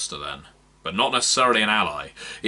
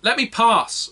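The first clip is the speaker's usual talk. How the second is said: much higher, loud commanding call; 14 semitones higher, 7 dB louder than usual. Acted. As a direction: order the crowd aside. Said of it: "a commanding voice called out".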